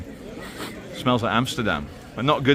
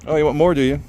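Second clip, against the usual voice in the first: normal talking voice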